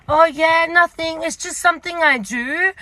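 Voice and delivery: high, over-casual brush-off; breezy excuse. Retold or imitated. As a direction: imitate playing it cool. Retold excuse to shop assistant.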